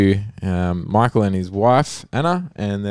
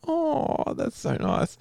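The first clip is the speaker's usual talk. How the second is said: delighted; 7 dB quieter, much slower than usual. Retold or imitated. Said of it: "you kinda like".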